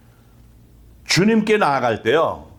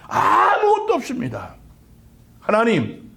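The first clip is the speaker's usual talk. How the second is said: high, pleading prayer to the Lord; earnest, raised-pitch supplication; crying out in prayer; 6 semitones higher than usual. Acted. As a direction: plead helplessly, drawing out the key words. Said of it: "주님 앞에 부르짖을 수 있어야 된다고요".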